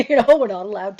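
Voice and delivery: real laugh breaking into loud talk. Real, not acted. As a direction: laugh your way into the explanation. Laughs at own example.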